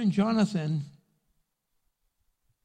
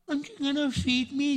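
put-on whiny baby; 8 semitones higher than usual. Mocking grown babies.

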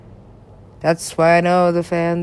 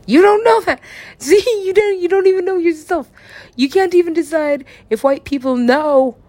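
indignant retort; 9 semitones higher than usual. Acted. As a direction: fire it right back, offended. Argues back at an imagined accuser.

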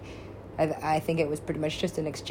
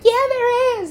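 high, louder mock insistence from fans; 8 dB louder, slower than usual. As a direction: squeal it like a fan. Voicing Excision fans.

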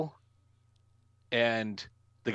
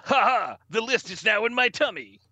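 mock villain gloat; 8 semitones higher than usual. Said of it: "eats it, and goes".

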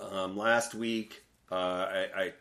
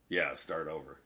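low, softer grumbled quote; imitated mutter; 5 semitones lower, 5 dB quieter than usual. Coach dismissing a question about the game, muttered. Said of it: "he just muttered".

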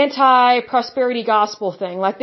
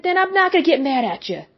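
usual speaking voice

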